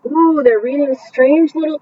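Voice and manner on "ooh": plain reading voice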